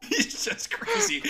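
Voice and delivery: high, squeaky-pitched laughing words; cracking up while talking. Real, not acted. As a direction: say it slowly through helpless laughter. Cracking up at partner's joke.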